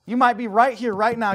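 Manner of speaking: high, loud preacher cry; full-force preaching. Acted. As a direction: call them out from the pulpit.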